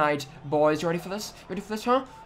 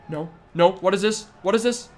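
ordinary delivery

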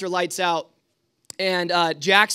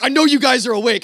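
loud, higher, quick accusation; mock angry yell, rushed and raised; retold yell; 5 semitones higher, 8 dB louder, faster than usual. Night watchman scolds boys feigning sleep, loud retold yell. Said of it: "if he just yells at us".

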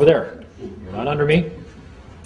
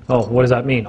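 usual speaking voice

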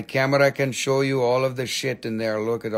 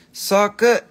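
high, louder delight; excited; 7 semitones higher, 6 dB louder than usual. Real, not acted. Seller praising a stone, excited and loud. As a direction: burst out with joy.

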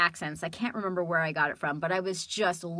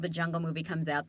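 ordinary talk, nothing marked

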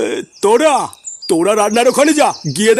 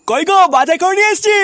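higher-pitched call to the wife; 6 semitones higher than usual. Acted. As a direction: holler through the house for your wife. Calls wife on coming home.

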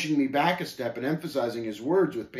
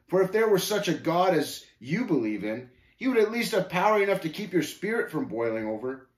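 higher, needling scoffer; lightly voiced scornful Shame, goading; 6 semitones higher, 4 dB louder than usual. Shame doubting the pilgrim's God, goading. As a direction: challenge with mild mockery.

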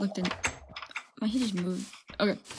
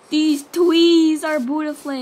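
gushing delight; 6 semitones higher, 8 dB louder, slower than usual. Real, not acted. Admiring trees in game.

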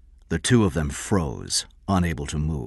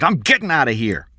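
angry, loud, raised pitch; shouting; 8 semitones higher, 6 dB louder than usual. A narrator's reading. Eddie walks out on Kathy, shouting. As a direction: storm out on the line. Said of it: "he shouted".

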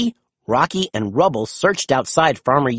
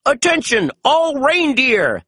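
loudspeaker announcement; 9 semitones higher than usual. A narrator's reading. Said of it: "he announced".

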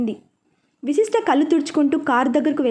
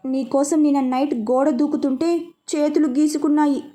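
plain reading voice

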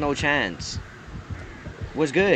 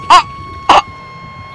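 sudden high-pitched shrieks of fright; shriek, caught off guard; real scream; 10 dB louder than usual. Real, not acted. Jumpscared by killer in game.

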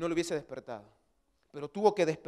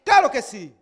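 loud, raised-pitch preacher's exclamation; emphatic shout; 5 semitones higher, 11 dB louder than usual. Real, not acted. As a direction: shout it out as a firm affirmation. Emphatic cry while preaching. (exclamación enfática a gritos)

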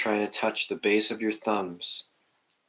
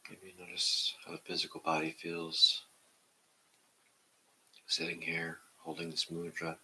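guided meditation; 2 semitones lower, 4 dB quieter than usual. Acted. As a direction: ease the class into stillness, half whispering. Leads a seated meditation.

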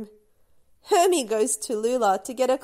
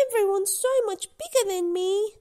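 sad little voice; 9 semitones higher, 3 dB louder than usual. Acted. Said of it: "Lula says sadly".

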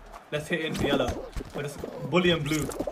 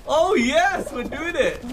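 high, loud glee; thrilled it's working; excited; 10 semitones higher, 4 dB louder, much slower than usual. Real, not acted. Player seeing his new strategy work, excited.

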